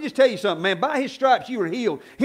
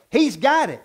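usual speaking voice

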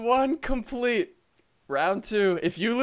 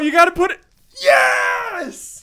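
frantic shouting; 6 semitones higher, 4 dB louder, slower than usual. Real, not acted. Frantic potion scramble.

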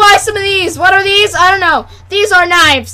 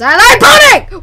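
loud mock ad outburst; hammed-up enthusiasm; 4 semitones higher, 6 dB louder than usual. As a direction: shout it like an over-keen salesman.

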